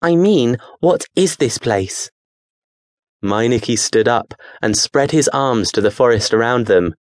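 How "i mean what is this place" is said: exasperated. Acted. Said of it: "becoming exasperated".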